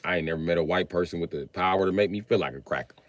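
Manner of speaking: normal talking voice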